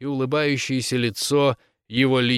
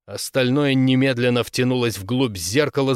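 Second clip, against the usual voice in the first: plain reading voice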